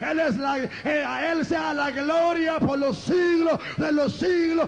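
high, sustained shouted exhortation, words stretched; full-force preaching. Real, not acted. Preacher rallying the congregation to praise Christ, high sustained preaching shout.

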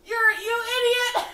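very loud, high scolding outburst; angry shout. Acted. Emma berates Zane over the drenched body, shouting. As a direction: yell the insult at full volume. Acted insult as casket slams.

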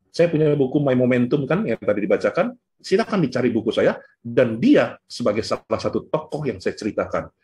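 usual speaking voice

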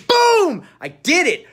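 real whoop of victory; triumphant shout. Real, not acted. Triumph after tongue twister.

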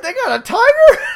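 high, loud, gleeful squeal; mock giddy delight; excited Negan. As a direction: imitate Negan thrilled about a tiger. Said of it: "he goes".

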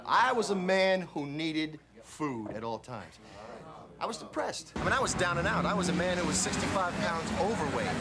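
loud, building preacher-style confession; motivational preaching. Acted. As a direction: pump the crowd up like a self-help guru. Motivational speaker building to shouting.

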